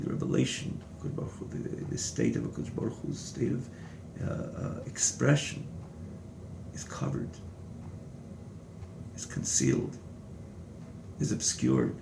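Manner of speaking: soft, almost whispered explanation; hushed. Real, not acted. Teacher explaining divine concealment to students, hushed. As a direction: drop to a near whisper, with long pauses.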